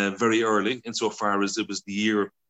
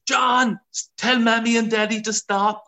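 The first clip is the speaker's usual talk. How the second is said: high, loud panicked shriek; screaming sisters; 13 semitones higher, 5 dB louder than usual. Retold or imitated. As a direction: yell in alarm as the van shakes. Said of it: "My sisters were screaming".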